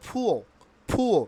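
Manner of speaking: "ফুয়ো" with puffed P, no R; correct pronunciation. Shown as a demonstration. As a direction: say "ফুয়ো" as "phu-o". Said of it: "পুয়ারের উচ্চারণ কী হবে". ("ফুয়ো", শেষে র ছাড়া)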